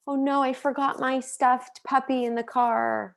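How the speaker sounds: put-on a small girl who just remembered her toy; little girl's dismay. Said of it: "my daughter's like".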